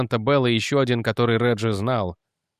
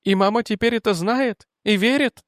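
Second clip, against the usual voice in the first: higher, breathless confession; distraught young woman; 9 semitones higher than usual. Acted. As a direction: spill it out in distress. Jennet voiced higher.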